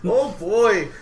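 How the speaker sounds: put-on high, loud Minnesota "Oh boy!"; mock Minnesota accent. Imitating a Midwest accent to the friends, a hammed-up Minnesota accent. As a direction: hams up a Minnesota accent for a laugh. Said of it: "that accent that's like".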